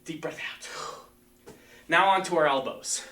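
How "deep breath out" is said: slow whispered breathing cue; soft slow breathing instruction; breathy exhale whisper. Shown as a demonstration. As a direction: whisper it slowly, letting the breath go. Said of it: "So, take a deep breath in".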